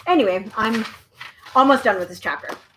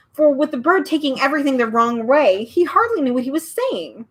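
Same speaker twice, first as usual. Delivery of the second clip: usual speaking voice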